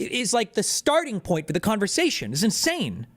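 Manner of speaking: normal talking voice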